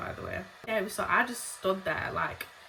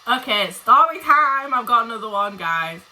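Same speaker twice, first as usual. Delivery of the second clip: excited announcement; 2 semitones higher, 10 dB louder than usual. Real, not acted. Telling viewers another story is coming, loud excited announcement.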